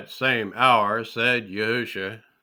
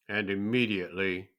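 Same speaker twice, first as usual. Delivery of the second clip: plain reading voice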